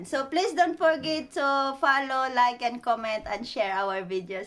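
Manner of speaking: slow, stretched-out outro call; vlog sign-off. Real, not acted. Vlogger asking viewers to follow and share, drawn-out sign-off. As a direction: draw out each phrase to the last word.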